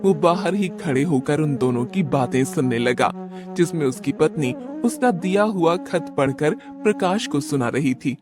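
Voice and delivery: ordinary delivery, nothing marked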